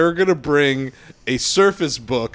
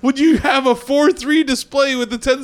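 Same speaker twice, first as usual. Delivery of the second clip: incredulous; 11 semitones higher than usual. Retold or imitated. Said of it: "I'm like".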